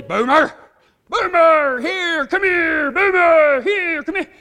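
high, loud, repeated dog commands; insistent shouted recall; yelling at dog. Retold or imitated. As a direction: holler to call back a runaway dog. Owner yelling at runaway dog.

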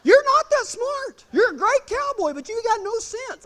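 high, insistent comic scolding; mock exasperation. Rider to reckless trainer, high exasperated rebuke. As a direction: tell him off in a squeal of disbelief.

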